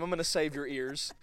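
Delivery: normal talking voice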